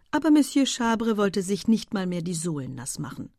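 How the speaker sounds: plain reading voice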